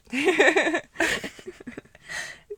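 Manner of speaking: loud high laugh; bursting laugh. Real, not acted. Laughing at dad's reason.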